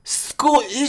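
high, loud hammed-up Scottish; mock Scottish accent. Host mocking the Scottish accent in a high, loud voice. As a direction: squeal it in a silly Scottish accent. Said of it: "I'm just kidding".

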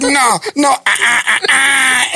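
high wailing refusal; imitated freak-out rising into cries; hammed-up horror. Neighbor hearing it is a possum, wailing in panic.

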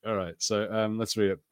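ordinary delivery

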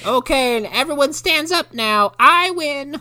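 put-on high kid declaring; lightly voiced little girl. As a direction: announce victory like a child.

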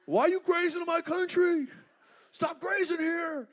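shouting herdsman; angry herdsman. Retold or imitated. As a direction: yell at the rival herder. Herdsman yelling at rival.

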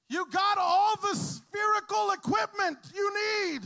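high, slow, loud comic shout; yelled encouragement. Retold or imitated. Youth pastor yelling to the dodging girl, loud and slow. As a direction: bellow it like a sideline coach. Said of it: "And then I yelled".

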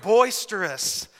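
Boisterous drawn out slowly, each syllable leaned on; savoring the word. Shown as a demonstration. Preacher modeling Boisterous for the congregation, slow and relished. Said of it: "Everyone say boisterous".

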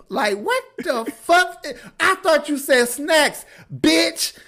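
high, drawn-out comic indignation; mock outrage. Teen complaining about the snacks he was promised, mock outrage. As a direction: build to a loud, high protest.

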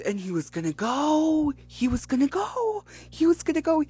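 high excited build-up. Real, not acted. Building excitement retelling near-miss.

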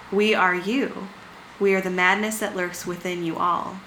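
reading a creepy story aloud; story narration. A narrator's reading. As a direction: tell it like a campfire horror tale.

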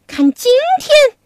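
hammed-up furious pig demon cursing; mock angry scolding. Pig demon curses Wukong while swinging his rake, mock angry. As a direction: spit out the insults like a brawler. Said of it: "大骂悟空".

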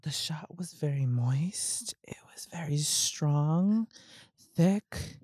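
slow sultry whisper. Pretend. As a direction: whisper it slowly, suggestive. Mock seductive whisper.

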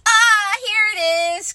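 high, loud excited find; bursting with discovery; excited cry. Acted. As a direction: Christopher Robin finding the present, excited. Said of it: "cried Christopher Robin excitedly".

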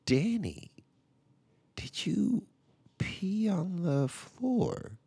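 high breathy slow question; slow suspicious whisper. Retold or imitated. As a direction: draw it out in a hushed interrogating whisper. Dad questions son, whispered.

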